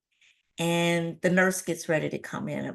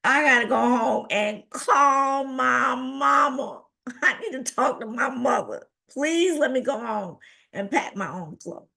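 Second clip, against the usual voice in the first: tearful pleading; 6 semitones higher than usual. Retold or imitated. Patient begging the doctor to go home, tearful. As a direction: beg in a mock wail, stretching words. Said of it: "I started crying and I said".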